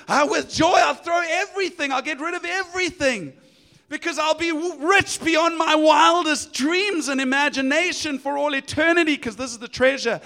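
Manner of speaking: high, loud burst of joy; a man who just found treasure, beside himself; overjoyed. Retold or imitated. Finder of the treasure shouts in delight. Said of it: "He goes".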